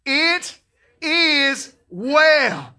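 It is well shouted high; loud conviction. Shown as a demonstration. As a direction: proclaim It is well with full faith. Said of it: "with all the conviction and faith".

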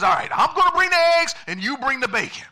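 high, loud, rapid enthusiasm; excited chicken. Acted. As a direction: blurt the plan out eagerly. Chicken eagerly assigns the food.

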